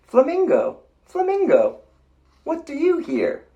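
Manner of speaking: refrain high and loud; high bird-like refrain. A narrator's reading. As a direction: keep the flamingo squawk in the refrain. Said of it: "refrain in flamingo pitch".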